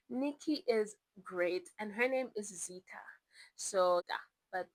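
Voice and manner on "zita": whisper. Real, not acted. Whispered name aside.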